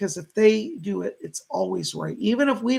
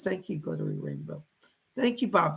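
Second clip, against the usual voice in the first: ordinary delivery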